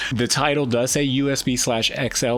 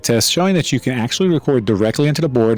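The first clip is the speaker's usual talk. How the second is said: loud mic through the board; talking into the board mic; board mic test; 7 dB louder than usual. Shown as a demonstration. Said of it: "a quick mic test showing".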